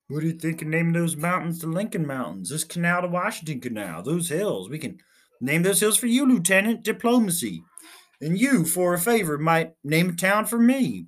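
a captain carried away with his own ideas; enthusiastic captain. Acted.

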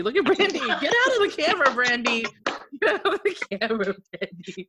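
loud, high, laughing call; laughing shout. Real, not acted. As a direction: yell at her through laughter. Laughing, calling out to friend.

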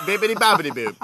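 high, loud gibberish Italian, laughing; fake Italian. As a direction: laugh through loud mock-Italian noises. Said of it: "pretend to speak Italian".

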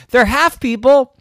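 high, loud objection; indignant retort. Acted. Ghost arguing with Wilt, loud protest. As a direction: burst in to correct him.

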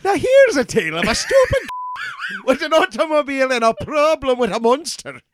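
high hammed-up announcer voice; mock announcer. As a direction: introduce the tale like a campy old radio host.

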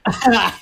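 loud high laugh; a sudden loud laugh; high burst of laughter. Real, not acted. Laughs at Shane.

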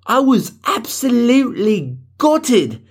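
high, slow, drawn-out British outrage; mock British accent. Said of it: "people experiment with voices all the time".

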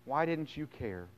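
soft, understated accusing plea; subdued grieving question; hurt reproach. Acted. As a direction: ask it quietly, as if let down. Voicing Mary's hurt reproach to Jesus.

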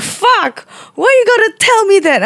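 high-pitched panicked outburst at the teacher, half laughing; scared near-scream. Retold or imitated. Student to sensei after a ghost story, high panicked cry. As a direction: squeal it out like a frightened scaredy cat. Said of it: "almost, like, screaming at her".

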